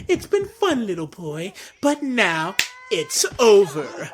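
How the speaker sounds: mock villain farewell, starting high; Breezy. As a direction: drag out the last words with hammed-up menace. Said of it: "Breezy used when he said".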